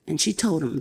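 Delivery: usual speaking voice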